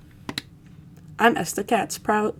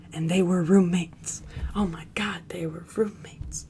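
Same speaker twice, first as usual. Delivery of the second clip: meme whisper. Pretend. Streamer whispers the roommates meme about Salem. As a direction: breathe it like a juicy secret.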